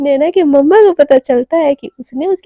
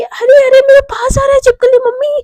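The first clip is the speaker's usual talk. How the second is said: high, loud faked fright over a lizard; mock panic scream; 9 semitones higher, 7 dB louder than usual. Shivani fakes fear of lizard, high loud shriek. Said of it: "ऐसे बोल के ना एकदम और चिल्लाने लगती है".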